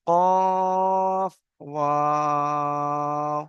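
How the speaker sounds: very slow recitation of letter names; drawn-out letter recitation. Shown as a demonstration. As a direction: stretch each letter name long and sung. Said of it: "বুঝতে পেরেছেন কেমন পরিবর্তন হলো".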